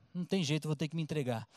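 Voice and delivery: entrega murmurada, bem mais baixa; resignada baixinho. Acted. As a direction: fale para si, já vencida. Mulher resignada, fala consigo. (mulher resignada, em voz bem baixa)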